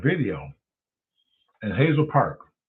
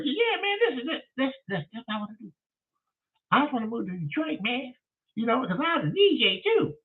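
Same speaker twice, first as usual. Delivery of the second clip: high squeaky voice for the caller; Leonard's voice; 11 semitones higher, 5 dB quieter than usual. Retold or imitated. Retelling the strange caller, high mimicking voice. Caller explains his plan.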